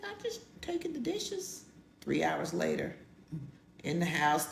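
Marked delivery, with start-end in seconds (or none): 0.1-1.6 mock innocent excuse. Excuse while slipping away.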